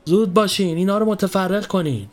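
officer's high, urgent command; shouted order. A narrator's reading. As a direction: order your men about. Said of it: "سر پاسبانها داد زد".

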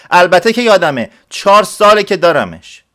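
high, loud certain reply; emphatic shout. Acted. Villager shouts to the clerk he knows his mule, high and loud. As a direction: shout it proudly. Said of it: "مرد دهاتی فریاد زد".

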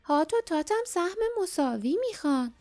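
high-pitched creature demand; sharp little monster voice. A narrator's reading. Hot and Tot demand an equal share, sharp high voices. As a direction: pipe it up high and insistent. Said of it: "صدای تیزشان".

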